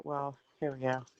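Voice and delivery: ordinary talk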